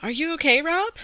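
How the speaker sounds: a hint of a concerned girl; girl's voice. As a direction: voice the girl asking gently. Girl asks Rob in story.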